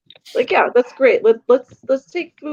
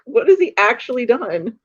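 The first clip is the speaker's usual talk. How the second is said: ordinary delivery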